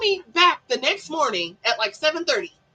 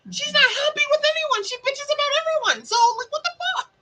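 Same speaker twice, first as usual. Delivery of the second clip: high-pitched indignant complaint; retold exasperated rant; exasperated venting; 11 semitones higher than usual. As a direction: vent in a high, fed-up rush. Boss venting, acted in retelling.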